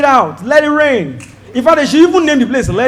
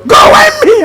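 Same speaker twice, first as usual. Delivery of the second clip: shout; 6 dB louder, slower than usual. Acted. Retelling being dumped, exaggerated.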